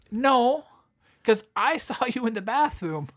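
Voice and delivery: put-on high, loud teasing girl's voice, laughing; mock girlfriend voice. Girlfriend telling him why, teasing and laughing. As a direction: tease him like a blunt girlfriend. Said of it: "And she goes".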